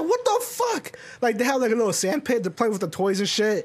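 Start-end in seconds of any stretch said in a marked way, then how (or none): 0.0-1.0 much higher, incredulous cry; mock outrage. Retelling his shock at the kids in the neighbor's yard, high. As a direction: squeal it in disbelief.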